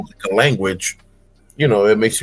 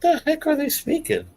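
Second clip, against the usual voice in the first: baffled onlookers; 12 semitones higher, 7 dB quieter than usual. Retold or imitated. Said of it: "people be looking at us like".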